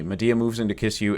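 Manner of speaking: ordinary talk, nothing marked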